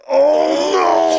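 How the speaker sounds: loud, drawn-out mock panic; panicked shout. Hearing her parents are from Liverpool, panicked shout. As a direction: cry out as the cover story collapses.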